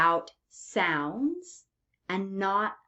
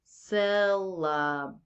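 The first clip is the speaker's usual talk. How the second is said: SYLLABLE slowed down into three separate beats; slower than usual. Shown as a demonstration. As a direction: say "syllable" slowly, one beat at a time. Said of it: "then you'll say a word like".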